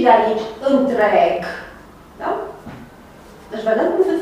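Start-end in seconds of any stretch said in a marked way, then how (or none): none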